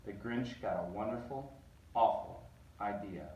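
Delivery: ordinary delivery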